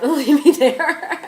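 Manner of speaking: loud, breaking into laughter; laughing. Real, not acted. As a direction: laugh through the absurd idea. Laughs at imagined kidnapping.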